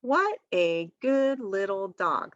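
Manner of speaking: slow, word-by-word dictation; slow and deliberate for a young learner; counting out words. Shown as a demonstration. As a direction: say each word separately, as if counting them. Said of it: "count the words with them and say".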